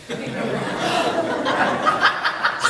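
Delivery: airy, relieved laugh; a real, relieved little laugh; breathless high laugh. Real, not acted. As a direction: laugh off the nerves, light and airy. Laughs after audience reassures.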